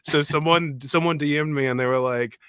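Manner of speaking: ordinary talk, nothing marked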